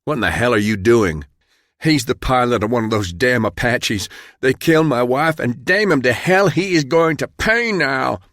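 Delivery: acted rage, pitch climbing as it builds; enraged outburst. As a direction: spit out fury and grief. Enraged accuser in fiction.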